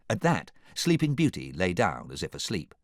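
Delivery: plain reading voice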